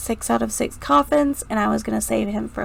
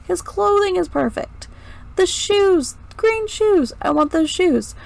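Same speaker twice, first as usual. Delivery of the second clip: excited gushing; 8 semitones higher than usual. Real, not acted. Gushing over clown's shoes.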